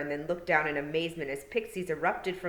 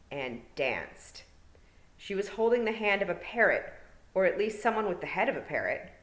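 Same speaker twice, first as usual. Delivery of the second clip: plain reading voice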